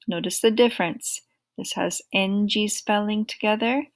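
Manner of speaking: normal talking voice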